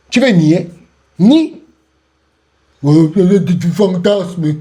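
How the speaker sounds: ordinary talk, nothing marked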